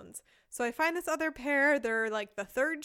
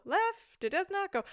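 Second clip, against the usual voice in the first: "Left, it does not go" with "Left" pitched higher, puzzled; higher puzzled try; 7 semitones higher than usual. Shown as a demonstration. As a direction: try the phrase again higher, hitting "Left". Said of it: "I try saying it in all these different ways".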